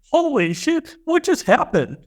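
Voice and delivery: high, overwhelmed retold exclamation in a mock Burmese accent; shocked cry. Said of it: "I'm just crying like a baby".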